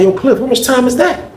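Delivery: high, lifted question; lightly voiced eager young inmate; high curious ask. Young inmate presses Cliff about his time, in a high voice.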